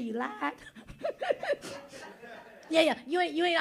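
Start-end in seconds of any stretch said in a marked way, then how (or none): 0.0-0.5 mock verdict. Preacher voicing judgment day, quiet stern verdict. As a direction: lower and softer, a hint of stern judge. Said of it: "They're gonna say".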